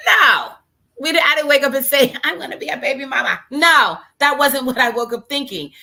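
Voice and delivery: high, loud, insistent no; emphatic protest. Real, not acted. Host insisting she never planned to be a baby mama, high and loud. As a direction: push back hard, voice up and loud.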